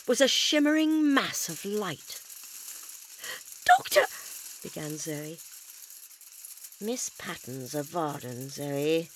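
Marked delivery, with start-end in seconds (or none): 3.7-4.1 high, loud cry of alarm; alarmed call. Acted. 6.8-9.1 low, slow and grim. Acted. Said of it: "said the doctor grimly".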